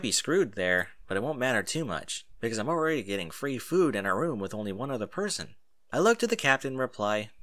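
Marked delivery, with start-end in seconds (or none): none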